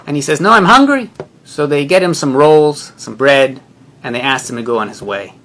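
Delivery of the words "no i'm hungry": high and loud, insisting he's hungry; insistent shout. Retold or imitated. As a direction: plead loudly. Homeless man refuses to leave.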